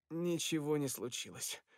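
plain reading voice

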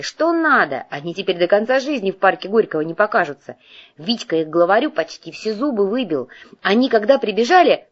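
put-on ringing girlish voice, building up; excited chatter. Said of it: "Звонкий девичий голос в трубке набирал обороты".

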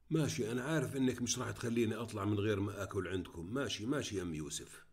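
ordinary delivery, nothing marked

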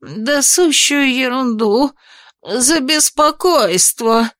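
high, slow terrified gulp; choking with fear. Acted. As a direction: stammer it out in terror. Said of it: "захлебнулся ужасом".

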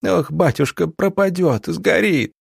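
high, wailing village woman; distraught, keening peasant woman; moaning lament. A narrator's reading. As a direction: lament in a high-pitched wail. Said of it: "простонала она".

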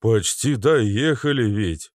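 медленно, с досадой в голосе; раздосадованно выдохнуть фразу. Acted. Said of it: "с досадой проговорил водитель". (водитель раздосадован, говорит медленно)

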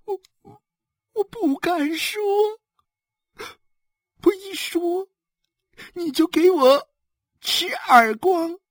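halting, tearful whine; frightened sobbing. Acted. Slapped wife dares not tell her husband, sobbing. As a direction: whimper it out, catching breath. Beaten wife afraid to speak, crying.

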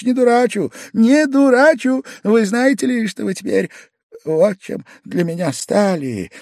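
put-on high, loud drunken protest easing off; Pavel Pavlovich, impassioned. A narrator's reading. Guest assuring host he is not fooling, high and impassioned. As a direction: protest high, then soften into confession.

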